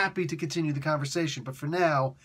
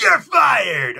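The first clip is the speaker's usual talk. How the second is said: big yelled you're fired; furious firing; 7 semitones higher, 11 dB louder than usual. Retold or imitated. Boss firing the writer on the spot, yelling. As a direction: roar it like a wrestling boss.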